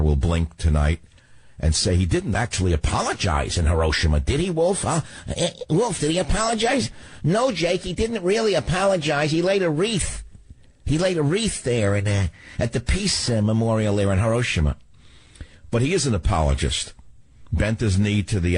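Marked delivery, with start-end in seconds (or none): 1.9-6.9 higher, prodding mock newsman voice; lightly lampoon a fawning anchor asking; mocking Jake Tapper. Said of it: "such as Jake Tapper and Wolf Blitzer". 7.2-14.8 higher, sing-song mock anchor reply; mocking Wolf Blitzer. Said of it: "such as Jake Tapper and Wolf Blitzer".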